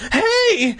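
very high-pitched indignant yelp; hammed-up angry cry; angry shout. A narrator's reading. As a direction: shout it angrily, voice leaping up. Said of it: "I shouted angrily".

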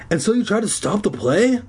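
high-pitched accusing question; demanding. A narrator's reading. Zeke confronting the Phantom about the play, demanding. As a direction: demand an answer. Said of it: "Zeke demanded".

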